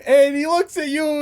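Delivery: ordinary talk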